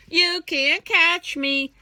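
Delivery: high, loud, slow taunt; teasing taunt. Acted. Last monkey taunting the crocodile, slow loud teasing. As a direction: stretch the dare out cheekily. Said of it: "teasing Mister Crocodile".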